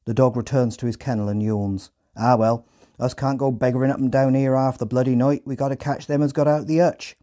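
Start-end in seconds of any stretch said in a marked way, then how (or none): 2.2-7.2 thick rural Hampshire farmer voice; Hampshire accent. Retold or imitated. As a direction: grumble like a Hampshire farmhand. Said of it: "in a thick Hampshire accent".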